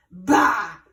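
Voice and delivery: fox's disgusted bah. A narrator's reading. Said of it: "said the big red fox".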